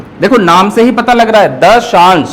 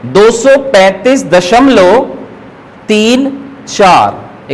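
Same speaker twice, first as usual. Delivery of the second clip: slow reading demo; much slower than usual. Shown as a demonstration. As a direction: slowly read each part of the number aloud. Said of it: "इसे अगर हम पढ़ेंगे तो कैसे पढ़ते हैं".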